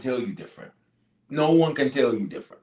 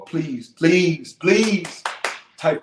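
higher, louder, stretched pleases; insistent pleading; 5 semitones higher, 4 dB louder, much slower than usual. Real, not acted. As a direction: plead harder with each please. Begging congregation to type along.

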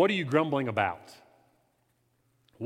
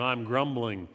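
ordinary talk

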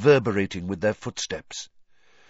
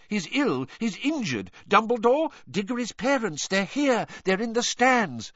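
high, fussing official voice; flustered minister; 11 semitones higher than usual. A narrator's reading. Fudge fussing loudly.

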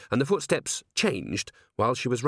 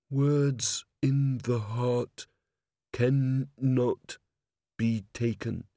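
very slow, word by word; solemn reading; much slower than usual. A narrator's reading. Vimes reading the golem's last words, solemn and slow. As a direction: weigh each phrase with long pauses.

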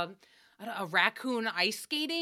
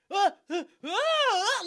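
having a heart attack; 9 semitones higher, 4 dB louder, slower than usual. Retold or imitated. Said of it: "he goes".

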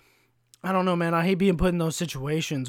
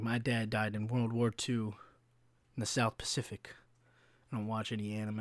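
put-on old veteran; 6 semitones lower, 9 dB quieter than usual. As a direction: mumble like a stern old veteran. Mock old veteran's reply.

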